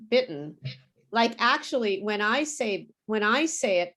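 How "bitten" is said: BITTEN with a catch in place of the T; silent middle T. Shown as a demonstration. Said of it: "I don't pronounce anything in the middle".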